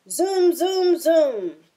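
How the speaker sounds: zooming sound. Acted. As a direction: whoosh by like a racer. Fox racing past again.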